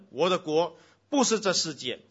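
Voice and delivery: booming sermon reading; preaching at full force. Acted. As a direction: proclaim it to the whole hall. Reads Jesus' words with force.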